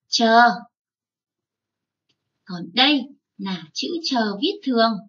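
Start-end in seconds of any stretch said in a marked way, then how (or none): 0.1-0.5 a loud, clear Chờ; model sound. Shown as a demonstration. Teacher modelling Chờ loudly for the children. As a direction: call out Chờ for the class to repeat. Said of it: "chúng mình thường thấy khi chúng mình đọc bài đấy".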